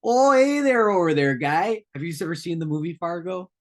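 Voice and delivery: put-on high, bright Upper Midwest North Dakota accent, Fargo style. Host doing Fargo-style North Dakota accent for cohost. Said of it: "this is a pretty accurate North Dakota accent".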